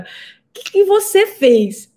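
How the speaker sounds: high, slow, amazed question; astonished doctor. Retold or imitated. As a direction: imitate the doctor's stunned disbelief. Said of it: "Ele falou desse jeito". (médico espantado, voz aguda e lenta)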